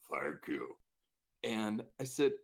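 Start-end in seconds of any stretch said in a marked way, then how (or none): 0.1-0.8 very low, relieved rumble; gravelly patient, eyes closed, relieved; deep growly voice. Retold or imitated. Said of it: "deep growly voice".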